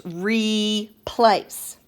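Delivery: "replace." slowly as RE, pause, PLACE; two drawn-out, separate syllables in "replace."; split into syllables. Shown as a demonstration. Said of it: "break this word apart".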